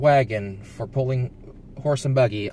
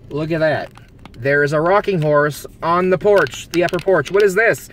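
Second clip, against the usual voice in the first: high, loud, eager pointing out; excited discovery; 5 semitones higher, 6 dB louder than usual. Real, not acted. Driver spotting a rocking horse upstairs, high excited discovery. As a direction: point it out with rising glee.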